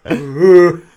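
loud, high hooted "ooh"; imitated horn blast. Imitating Boromir blowing his horn, big mock blast. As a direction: hoot like a war horn. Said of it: "he blows his horn".

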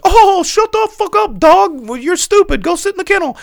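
high, loud squeaky chipmunk voice; hammed-up chipmunk mocking the dog; cartoon chipmunk voice. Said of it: "and he's like".

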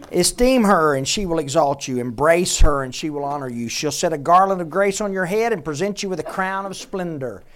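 emphatic, punched preacher's reading; forceful scripture reading. Acted. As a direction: proclaim the verse to the congregation. Reads scripture aloud with force.

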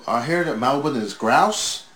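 mock arena announcement; imitating the wrestler. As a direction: play to the crowd, slowly and loudly. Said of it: "And he goes".

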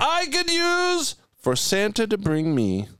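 high, slow, stretched-out proclamation; grand announcing. Pretend. Host announcing his Christmas wish for Santa, high slow proclamation.

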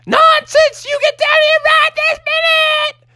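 very high falsetto mama voice; bossy Mother Bear. Acted. Mother Bear calling children to breakfast, high bossy falsetto. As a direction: order the kids down in shrieky falsetto.

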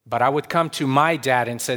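ordinary delivery

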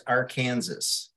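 "Arkansas" as ar-KAN-zəs, final S sounded; Arkansas said like Kansas. Shown as a demonstration. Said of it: "get you to pronounce the state".

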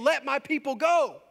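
slow, commanding plea to Pharaoh; a prophet demanding freedom; Moses demanding. Acted.

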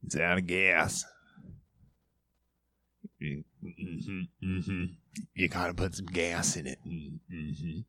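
a hint of a Sling Blade drone; lightly done big dim pet snake answering softly; Big Snake's voice. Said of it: "That's my Big Snake".

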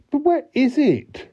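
very high-pitched exasperated question; cross. A narrator's reading. Man fed up with the tattoo hunt, cross. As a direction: squeak it out in mock crossness. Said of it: "he was cross".

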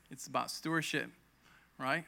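ordinary talk

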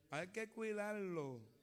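drawn out and soft, each word weighed; preacher repeats the warning to the congregation, slow and soft; slow soft emphasis. Shown as a demonstration. Let the words sink in, slowly and softly. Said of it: "pero el territorio hay que cuidarlo".

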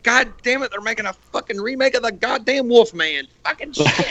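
high-pitched mock old-timer grumble; grumbling grandparents. Old folks griping about a remake, hammed-up cranky grumble. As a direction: gripe like a cranky great-grandparent. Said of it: "our grandparents and great grandparents sit around like".

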